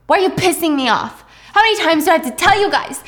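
exasperated boss shouting; angry berating. Retold or imitated. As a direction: fire the questions at the staff. Heiress berates employees.

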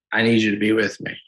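ordinary talk

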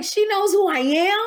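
high, loud and amazed, pitch shooting up; stunned disbelief. Retold or imitated. To the host, recalling the superstar knowing her name, in high loud disbelief. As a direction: gasp it out like you can't believe she knows you. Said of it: "I was like".

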